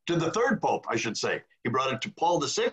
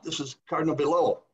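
ordinary talk, nothing marked

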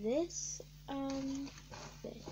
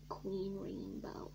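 hushed whisper, slow; 2 semitones lower, 7 dB quieter, much slower than usual. Real, not acted. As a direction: breathe the name softly. Whispered toy name.

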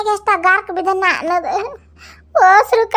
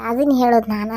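normal talking voice

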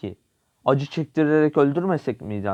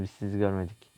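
hushed; 4 semitones lower, 8 dB quieter than usual. Pretend. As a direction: drop to a near hush, softly. Sneaking past enemies, joking. (alçak sesle, gizlice)

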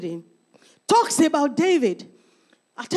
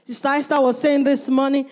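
normal talking voice

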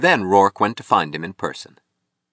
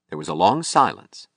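plain reading voice

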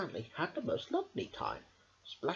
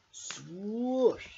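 rising whoosh sound; swoosh; 7 semitones higher than usual. Acted. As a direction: make the umbrella whoosh up. Sound effect in story.

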